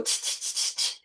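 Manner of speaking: voiceless monkey "ch-ch-ch" imitation; evenly spaced chittering noises; monkey chatter. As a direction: chatter back like cheeky monkeys, no voice. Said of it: "And the monkeys go".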